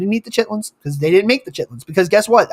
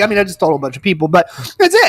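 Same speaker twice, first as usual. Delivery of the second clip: normal talking voice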